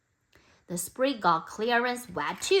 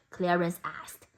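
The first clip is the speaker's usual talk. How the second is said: usual speaking voice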